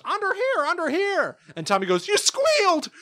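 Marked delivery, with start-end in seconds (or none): 0.0-1.4 very high squawked repetition; high-pitched bird echo; mimicking parrot. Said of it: "And the parrot goes". 2.1-2.9 very high-pitched mock accusation; high indignant kid voice; cartoon baby Tommy. Tommy accuses the tattling parrot.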